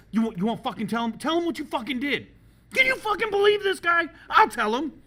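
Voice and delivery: high, loud ranting that builds; hammed-up outraged yelling; imitated worked-up rage. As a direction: act out a red-faced, escalating tirade. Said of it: "He's so worked up".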